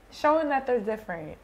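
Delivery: ordinary talk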